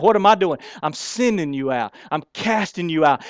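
usual speaking voice

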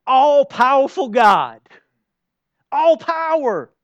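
high, loud, slow cry of praise; soaring slow praise; exalted proclamation. Real, not acted. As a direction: lift it up to God. Preacher exalting God.

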